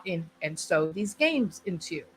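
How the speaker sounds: usual speaking voice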